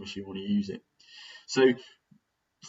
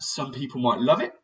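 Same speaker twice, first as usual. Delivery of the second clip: ordinary delivery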